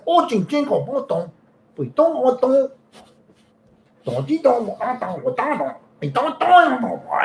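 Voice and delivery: high sing-song mock gibberish. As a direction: chirp nonsense syllables up high. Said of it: "she's like".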